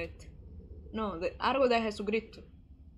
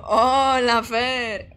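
loud greeting; 11 dB louder, much slower than usual. Real, not acted. Greets a viewer loudly.

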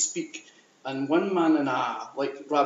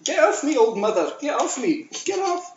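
high, squawked protest; acted protest; 10 semitones higher, faster than usual. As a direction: fend someone off in a much higher voice. Acted struggle with old mother.

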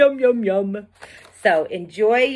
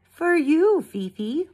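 father's voice; 9 semitones higher than usual. Acted. Father gives daughter a flute.

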